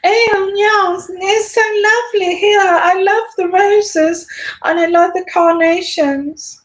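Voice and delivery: very high, genteel royal-set voice; mock aristocrat. Vlogger mocking how the royals all talk, high aristocratic impression. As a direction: put on a high-pitched aristocratic lilt. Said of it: "we all talk like this".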